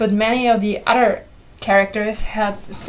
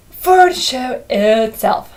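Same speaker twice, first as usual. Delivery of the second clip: ordinary delivery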